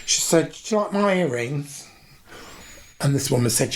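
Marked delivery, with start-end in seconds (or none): 0.5-1.9 imitating mum. Mum at cruise dinner table.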